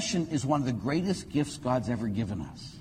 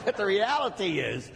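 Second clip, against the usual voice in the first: ordinary talk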